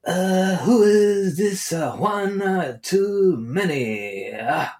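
high, slow, halting put-on Korean accent; puzzled kid in a mock Korean accent, drawn out; imagined Korean kid. Korean kid finding the podcast, mock Korean accent. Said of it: "some Korean kid".

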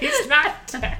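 high, slow laughing objection; laughing protest. Real, not acted. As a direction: protest while cracking up.

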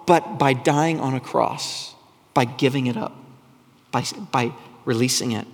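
low, hushed and measured, letting it land; gentle gravity. Acted. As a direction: drop low and soft. Jesus' quiet answer on the cross.

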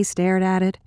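plain reading voice

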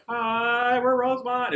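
high, awkward squeaky hello to a crowd; nervous greeting. Retold or imitated. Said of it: "I'm just like".

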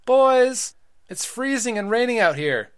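high, loud call across the yard. Acted. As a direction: call the kids inside. Mom calls from house.

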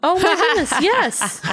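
high, delighted outburst through laughter; laughing. Real, not acted. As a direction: crack up while agreeing. Reacts laughing to disturbing image.

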